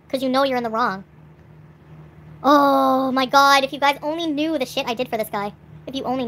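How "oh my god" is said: long drawn-out exasperated groan of the words, louder; exasperated oh my god. Real, not acted. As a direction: stretch it out, fed up and loud. Venting about her ex on camera.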